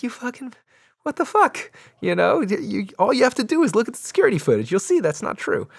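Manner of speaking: very high, fast indignant protest; being arrested unfairly, high fast outraged mock protest; mock outrage. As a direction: sputter in disbelief at the arrest.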